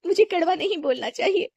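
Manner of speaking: sorry mother on the verge of crying; mild acted crying; tearful apology.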